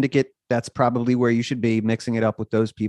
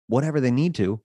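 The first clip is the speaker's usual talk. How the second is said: usual speaking voice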